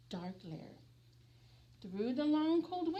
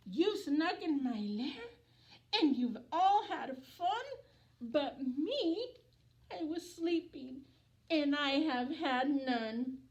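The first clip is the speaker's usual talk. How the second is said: lilting storybook voice for little ones; gentle singsong picture-book narration; reading to children; 2 semitones higher, 4 dB louder than usual. A narrator's reading. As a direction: read it like bedtime story time. Reads picture book aloud.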